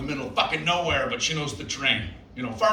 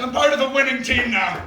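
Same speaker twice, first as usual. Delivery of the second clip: smug boast; 7 semitones higher, 5 dB louder than usual. Retold or imitated. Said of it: "I'm like".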